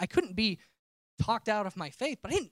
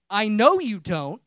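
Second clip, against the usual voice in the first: voice of God; 11 dB louder, slower than usual. Retold or imitated. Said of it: "hearing God say".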